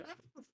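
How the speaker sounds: tiny, high, quiet yelp; imitated dog yelp. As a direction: squeak out a little dog yelp. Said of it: "the dog goes".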